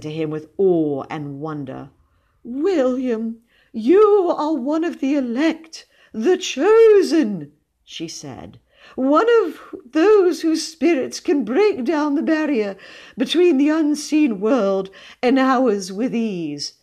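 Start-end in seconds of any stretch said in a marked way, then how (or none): 2.4-7.5 high, slow, rapturous declaiming; awestruck cousin. A narrator's reading. Gullible cousin hailing the boy as one of the elect, awestruck. 8.9-16.7 high, loud, rapturous proclamation; awestruck cousin. A narrator's reading. Cousin marvelling at the boy's spirit powers, awestruck. Awed cousin continues praise of boy.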